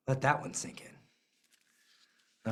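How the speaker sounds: whispered, drawn-out; hushed and slow. Real, not acted. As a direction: breathe it out slowly. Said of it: "dramatic pause".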